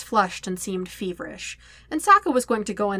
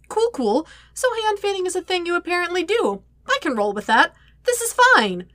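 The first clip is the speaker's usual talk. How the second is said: higher, nervous babble that keeps climbing; flustered rambling; 10 semitones higher, 4 dB louder than usual. A narrator's reading. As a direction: ramble on, trying to sound fine and failing. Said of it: "Sokka rambles to no one".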